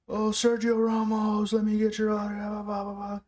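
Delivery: high, chanted fan plea; mock fan shouting. Fan begging Sergio Ramos for an autograph, high mock shouting. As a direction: mimic a fan calling out in a high voice. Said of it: "There's all the shouting".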